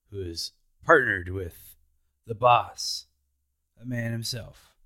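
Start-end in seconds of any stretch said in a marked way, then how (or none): none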